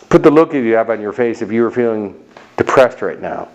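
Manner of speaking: ordinary talk, nothing marked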